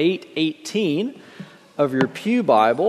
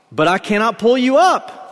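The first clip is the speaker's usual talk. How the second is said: raised-pitch protest, not truly shouted; mild cry out; 8 semitones higher than usual. A narrator's reading. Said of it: "the man cried".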